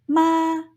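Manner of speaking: "ma" held high and level; Mandarin first tone. Shown as a demonstration. Teacher demonstrating first tone "ma", high and level. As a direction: say "ma" on a high, steady pitch. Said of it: "they should sound something kind of like".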